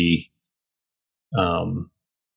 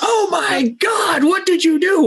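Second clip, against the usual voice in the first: shocked shout. Pretend. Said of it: "they are like".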